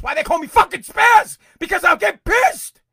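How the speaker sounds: furious high-pitched shout; pissed-off outburst. Real, not acted. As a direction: explode into loud, real anger. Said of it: "or a calmer way. I can't".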